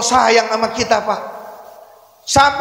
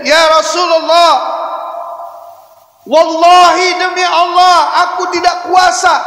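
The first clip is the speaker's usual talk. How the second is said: anguished outcry; 4 semitones higher than usual. Acted. Jibril's tearful reply.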